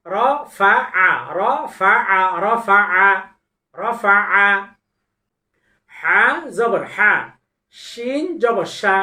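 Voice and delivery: loud, deliberate syllable recitation; Quran recitation drill. Shown as a demonstration. Quran teacher reciting syllables for students to repeat.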